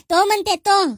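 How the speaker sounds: mock her voice back at her; mocking mimicry. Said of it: "कशी बोलते तुझ्या बापाला".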